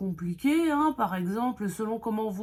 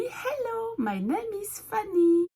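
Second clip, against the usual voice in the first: high, beaming self-introduction; big-smile greeting; 14 semitones higher than usual. Retold or imitated. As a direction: greet them sweetly through a huge grin, pitched way up. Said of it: "avec un grand sourire et je leur ai dit".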